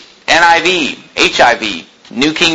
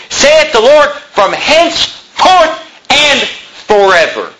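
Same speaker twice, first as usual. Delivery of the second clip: rising, slow, ringing pulpit reading, much higher; preaching scripture; 6 semitones higher than usual. Acted. Pastor proclaiming the verse to the congregation, slow and soaring. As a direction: read the promise out like a proclamation, building.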